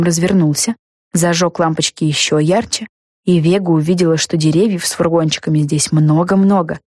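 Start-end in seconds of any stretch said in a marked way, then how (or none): none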